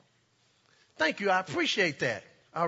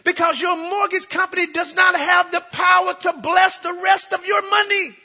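loud, high preaching cry; preaching at full force; 9 semitones higher, 7 dB louder than usual. Acted. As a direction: proclaim it over the congregation.

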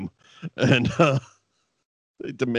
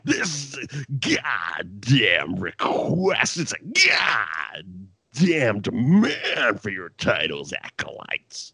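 very slow, drawn-out sleazy promo; X-Pac heard as the vampire wrangler; slower than usual. Retold or imitated. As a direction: stretch every goddamn like a carnival barker. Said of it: "that's all I could hear with X-Pac talking".

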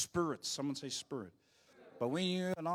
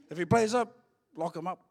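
shouting across; 4 semitones higher, 8 dB louder than usual. Retold or imitated. Cousin shouting across the road.

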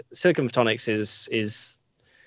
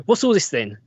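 Cockney police constable; Cockney accent; 5 semitones higher, 4 dB louder than usual. Retold or imitated. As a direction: drop into broad Cockney, louder. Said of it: "proper Cockney accent".

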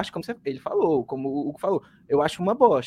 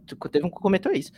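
ordinary talk

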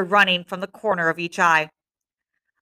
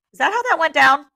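ordinary delivery

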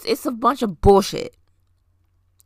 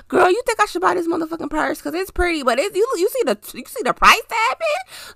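put-on high, sassy girlfriend voice asking for advice; gossiping girlfriend; 8 semitones higher than usual. [name] asking her friends about a purse, high sassy girlfriend voice. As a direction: play the friend in the group chat, pitched way up. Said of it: "Ask your friends".

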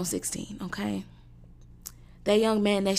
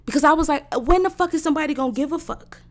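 louder, higher; angry outburst; 7 semitones higher, 7 dB louder, faster than usual. Real, not acted. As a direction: let it boil over. Ranting outburst.

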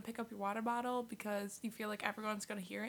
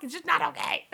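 loud exasperated finish; indignant; 4 semitones higher, 11 dB louder than usual. Real, not acted. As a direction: build to an outraged, punchy verdict. Indignant climax of the complaint.

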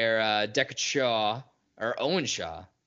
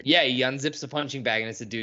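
usual speaking voice

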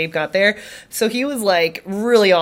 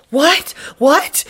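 acted-out startled question, pitched high; sharp rising question, as if short of breath; breathless disbelief; 8 semitones higher, 4 dB louder than usual. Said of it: "can't breathe".

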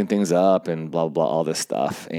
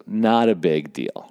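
normal talking voice

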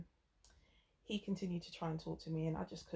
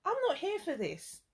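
fed up; 9 semitones higher, 4 dB louder than usual. Retold or imitated. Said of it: "it was like".

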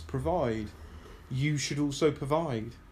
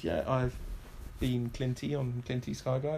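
ordinary talk

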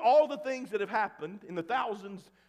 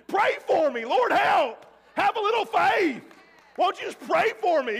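pleading; 9 semitones higher, 7 dB louder than usual. Retold or imitated. Dad pleading while unable to breathe.

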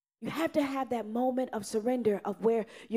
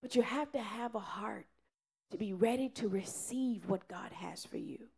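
whisper; 2 semitones lower, 9 dB quieter than usual. Acted. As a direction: drop to a hushed whisper. Sudden hushed turn after outburst.

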